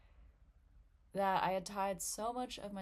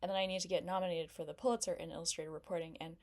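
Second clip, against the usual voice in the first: escalating rush; faster than usual. Acted. Comic rushes through her revenge plan, speeding up. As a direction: pile each goal on faster and faster.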